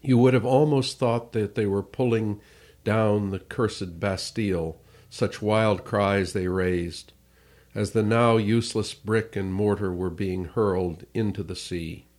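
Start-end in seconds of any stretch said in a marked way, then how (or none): none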